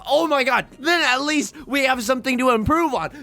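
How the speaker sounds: high, loud, fast frantic rambling; self-deprecating rant. Real, not acted. Streamer ranting about misplays to chat, frantic and high. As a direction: spiral into a panicked apology.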